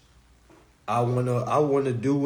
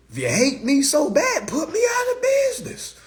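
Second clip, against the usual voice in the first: much higher, loud real challenge; defiant shout; 11 semitones higher, 3 dB louder than usual. Real, not acted. Streamer dares hateful commenters to beat him, defiant shout. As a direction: throw it at the haters as a dare.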